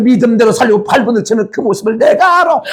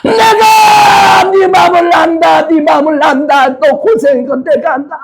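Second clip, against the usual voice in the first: comforting Lord; 6 semitones higher than usual. Acted. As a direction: shout it out as Jesus wiping her tears. Jesus comforts and crowns bride.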